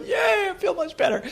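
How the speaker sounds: high, mock-triumphant little cheer; comic self-satisfied delight; gleeful cheer. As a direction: cheer yourself on with playful glee. Retells gleeful bedtime after eating muffins.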